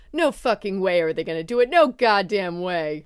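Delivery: loud, emphatic disbelief; loudly incredulous, building; retold mounting disbelief. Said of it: "I was like".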